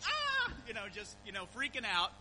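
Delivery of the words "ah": short, very high scream of fright; retold shriek of a startled sister. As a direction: yelp like you were just scared senseless. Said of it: "And Sarah inside goes".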